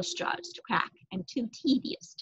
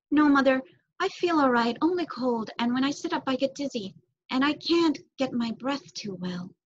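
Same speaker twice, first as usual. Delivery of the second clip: put-on higher voice of an ailing boy; sick son reassuring his worried mother in a higher voice; sick young son; 5 semitones higher than usual. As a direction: pitch up for the sick child, answering his mother. Son Timothy answers mother.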